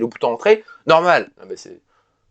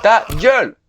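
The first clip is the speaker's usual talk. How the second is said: louder, higher snap of rage; angry yell; 7 semitones higher, 4 dB louder, slower than usual. Real, not acted. Gamer snapping at the game's noise, angry yell. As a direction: shout at the game to shut up. Yelling at the game.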